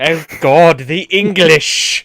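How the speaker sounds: slow, drawn-out posh English sneer. Retold or imitated. Mocking the English to friends, slow posh British drawl. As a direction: drag out the words with British disdain.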